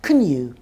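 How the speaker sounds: "Can" as kun, run straight into "you"; "Can you?" with no gap between the words; weak "Can" joined to "you"; linked weak can. Shown as a demonstration. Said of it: "It links with the next word".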